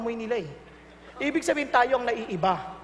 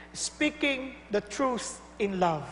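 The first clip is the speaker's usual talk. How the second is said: solemn; 5 semitones lower, 8 dB quieter, much slower than usual. Real, not acted. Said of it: "slow solemn statement".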